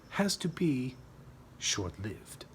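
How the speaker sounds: ordinary delivery, nothing marked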